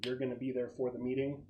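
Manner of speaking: ordinary delivery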